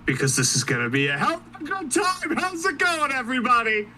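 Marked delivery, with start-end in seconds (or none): 1.2-3.9 a hint of a goofy Batman voice, higher and fast; mock superhero greeting; stupid Batman voice. Co-host greets listeners in a lightly voiced silly Batman. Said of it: "I do this stupid Batman voice".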